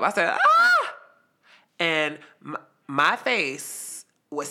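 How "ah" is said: sharp high yelp; loud, startled re-enacted yelp. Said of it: "this yelp".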